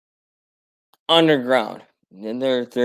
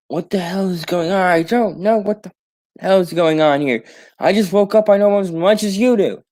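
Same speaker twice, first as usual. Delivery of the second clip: panicked confusion; 6 semitones higher than usual. Retold or imitated. Confused heroes waking to chaos.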